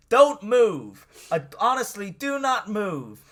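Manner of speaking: loud, high shouted order; loud mock command. Host acting out Captain Jack halting Graham, loud and high. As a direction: bark it like a hero bursting in. Captain Jack's entrance acted out.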